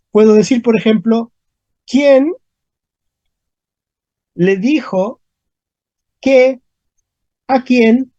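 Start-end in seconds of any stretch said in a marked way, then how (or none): 1.9-8.1 "quién ... le dijo ... qué ... a quién ... Dónde" with long pauses; piece by piece. Shown as a demonstration. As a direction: stack up each question word separately, "quién", "qué", "Dónde". Teacher builds question piece by piece.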